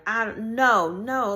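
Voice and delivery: loud exasperated refusal, breaking off; frustrated outburst. Retold or imitated. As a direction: push the words away, fed up. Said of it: "Like, I was frustrated".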